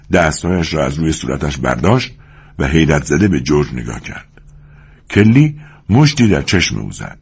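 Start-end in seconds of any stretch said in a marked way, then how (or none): none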